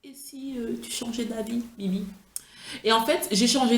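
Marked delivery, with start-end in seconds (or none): 0.0-2.0 soft, hesitant inner voice; musing to oneself. Retold or imitated. Vlogger retelling her second thoughts about the earrings, softly musing. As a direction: quietly voice a tentative second thought. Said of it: "elle se dit".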